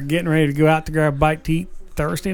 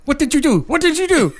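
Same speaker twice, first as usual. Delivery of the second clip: high-pitched imitation of an alarmed scammer; acted outraged alarm down the phone; mock panic; 10 semitones higher than usual. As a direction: imitate frantic disbelief, pitch jumping up. Imitates scammers finding accounts drained.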